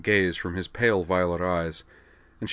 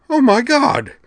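high, loud shriek of horror; terrified cry; 11 dB louder, slower than usual. A narrator's reading. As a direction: scream at the sight of the blood. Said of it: "Liza cried".

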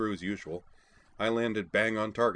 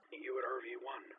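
put-on higher, soft hushed girl's voice; higher and hushed, like over a radio; hushed young woman; 6 semitones higher, 9 dB quieter than usual. A narrator's reading. Sakura whispering over radio.

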